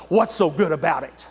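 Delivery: low, sour grumble; a grouch brushing off the greeting; lightly voiced grumpy stranger; grumpy reply. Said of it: "they'll say".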